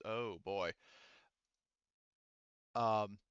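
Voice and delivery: normal talking voice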